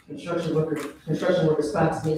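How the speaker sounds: usual speaking voice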